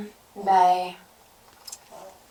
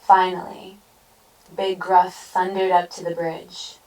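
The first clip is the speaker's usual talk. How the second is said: ordinary delivery, nothing marked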